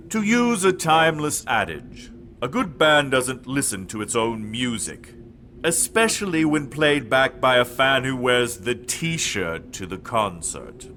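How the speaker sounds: Dark Lord voice. Acted. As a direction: speak as the dark master, low and cold. Dark Lord answers Edmund.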